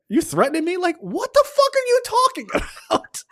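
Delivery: high shrill mock indignation; hammed-up angry comeback; mock outrage. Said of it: "She's like".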